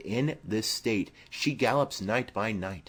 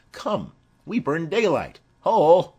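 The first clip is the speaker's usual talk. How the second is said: higher, then a loud shouted Ho; rousing call; 9 semitones higher, 10 dB louder than usual. Acted. Mercutio urges the maskers onward with a loud call. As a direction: hurry the group off with a shout.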